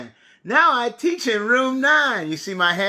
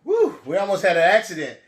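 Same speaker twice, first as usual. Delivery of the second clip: put-on reading to a toddler; slower than usual. Counting aloud for children.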